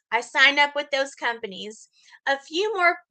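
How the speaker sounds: ordinary delivery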